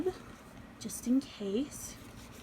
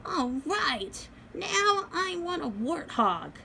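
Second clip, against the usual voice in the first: doll voice; 8 semitones higher, 3 dB louder, slower than usual. Acted. Doll asks for warthog.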